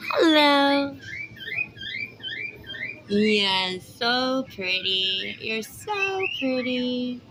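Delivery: high, loud sing-song cooing at a bird; pet talk. Real, not acted. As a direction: coo to the parrots like babies.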